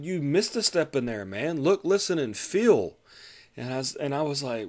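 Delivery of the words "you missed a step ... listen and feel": high, loud exasperated scolding; mock lecture, punching look and listen. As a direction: chew out the rookie. Scolding the trainee mid-drill.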